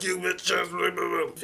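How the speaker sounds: slow, dragged-out angry insult; mad drawn-out insult. Retold or imitated. Homeless man snapping at the narrator, slow angry insult. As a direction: stretch the insult out angrily. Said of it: "He gets mad".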